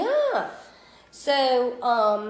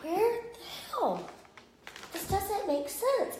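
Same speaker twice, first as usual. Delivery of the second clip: mock-bewildered, higher and softer, slowing down; baffled, higher voice; 8 semitones higher, 7 dB quieter than usual. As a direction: replay your own confusion in the aisle, higher and hushed. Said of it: "I'm like".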